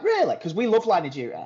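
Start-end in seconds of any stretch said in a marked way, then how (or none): 0.0-0.4 very high, disbelieving "really?"; retold surprise. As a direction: squeak it high like a doubtful partner. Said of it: "And she was like".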